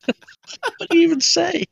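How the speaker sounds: high laughing squeal. Real, not acted. Laughing at failed pirate try.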